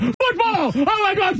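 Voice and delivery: high-pitched, frantic yell of a game announcer; mock broadcast-booth shouting, way up high; excited football broadcaster. Host mimicking a frenzied live game call, high excited shouting. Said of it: "you sound like the Bears, uh, broadcast".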